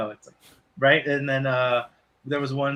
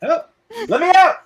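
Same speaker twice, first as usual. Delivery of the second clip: very high, loud cry; trapped AirPod yelling; tiny trapped voice; 8 dB louder than usual. Acted. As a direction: shout it high like a tiny prisoner.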